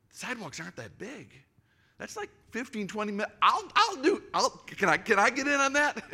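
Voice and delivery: high, excited voice climbing to loud; eager jump-in. Retold or imitated. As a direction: jump at the chance, keen and loud. Narrator's own eager thought retold.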